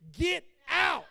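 loud, high commanding rebuke; hammed-up mama shouting at the devil; mama rebuking. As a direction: cast it out like mama with the oil. Mother anointing house.

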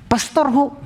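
ordinary talk

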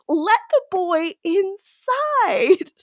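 high, slow, drawn-out appeal; mock pleading. Host appealing to the gang to let Luke in, mock pleading. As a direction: stretch it out in a playful high plea. Pleading on excluded Luke's behalf.

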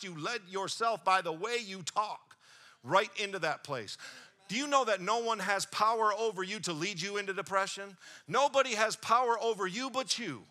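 full-force sermon delivery; preaching. Acted. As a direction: drive it home to the congregation. Preaching, building to a point.

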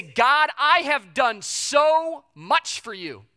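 high, loud entitled whine at God; self-righteous complaint. Retold or imitated. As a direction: demand payback from God. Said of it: "We say".

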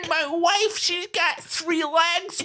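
squeaky cartoon man panicking; high comic character voice. Comic character voice, much higher.

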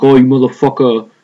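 lightly mocking Sopranos tough-guy voice; hammed-up mob-show swearing; Chris Cuomo, pissed off. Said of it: "like he's on The Sopranos".

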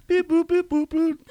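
high robotic beep boop noises. Pretend. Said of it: "Kevin loves the beep boop thing".

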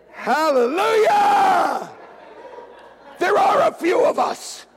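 high, drawn-out hallelujah shout; jubilant shout. Real, not acted. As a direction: cry out in triumph to the congregation. Jubilant shout to the raised hands.

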